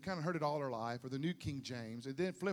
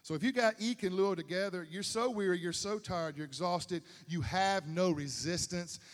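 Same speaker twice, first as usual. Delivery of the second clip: normal talking voice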